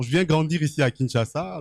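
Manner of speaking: normal talking voice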